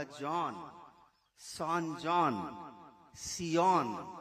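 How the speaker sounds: "सान जॉन, सियोन" spelled out slowly, syllable by syllable; Korean pronunciation. Shown as a demonstration. Lecturer shows the Korean forms of the word: slowly sounds out "सियोन". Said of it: "इसका उच्चारण हुआ".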